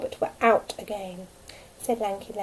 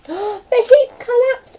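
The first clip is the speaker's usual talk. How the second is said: slow, loud and much higher; storyteller building to collapse; dramatic gasp; 14 semitones higher, 13 dB louder, much slower than usual. A narrator's reading. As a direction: gasp then announce the collapse. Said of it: "dramatic climax".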